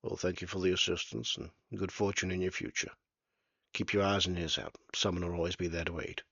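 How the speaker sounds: a hint of a weary river man saying goodbye; old ferryman Samuel. Samuel farewell to lawmen.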